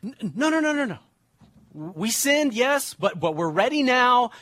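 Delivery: put-on high, whiny pleading like a child; childish pleading. Preacher mocking the Israelites as whiny children. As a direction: beg like a kid caught out. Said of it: "they're like".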